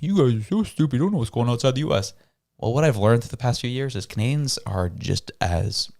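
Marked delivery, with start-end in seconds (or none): 0.0-2.1 higher, sneering put-on voice; mocking Americans. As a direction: imitate a smug Canadian mocking Americans. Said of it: "We make fun of Americans".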